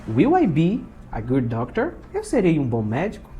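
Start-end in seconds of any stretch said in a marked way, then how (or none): 0.1-1.9 "Will I be" then a rise on DOCTOR; question intonation. Shown as a demonstration. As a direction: ask it in two parts, lifting DOCTOR at the end. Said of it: "E faço a entonação".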